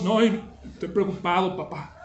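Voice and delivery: put-on soft, fading little boy's voice; a pale, fretful child's murmur; worried child. Son repeating his worry to his father, a soft fading murmur. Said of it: "su voz".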